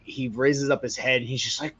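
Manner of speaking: ordinary delivery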